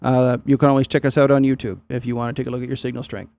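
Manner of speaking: normal talking voice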